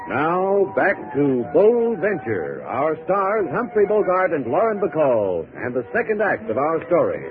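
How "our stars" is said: normal talking voice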